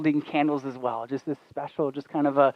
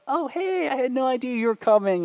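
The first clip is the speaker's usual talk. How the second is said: high-pitched fake-surprised greeting; feigned surprise; 10 semitones higher than usual. Boyfriend pretending he didn't know she was coming, feigned surprise. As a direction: act caught off guard, voice leaping high. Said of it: "I acted surprised when I saw her".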